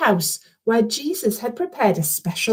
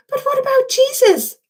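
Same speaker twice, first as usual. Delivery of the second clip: put-on much higher frightened child; anxious question. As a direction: Alfie fears for Jesus, anxious. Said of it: "said Alfie".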